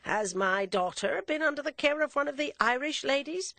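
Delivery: lightly voiced father with a sly joke; teasing gentleman. A narrator's reading. Father teasing the nuns, lightly voiced and sly. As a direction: slip him a dry little joke.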